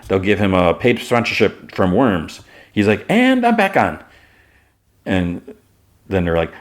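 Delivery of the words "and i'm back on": much higher, sing-out enthusiasm; acted eager comeback. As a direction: jump back in with pitch soaring. Jimmy suddenly persuaded by offer.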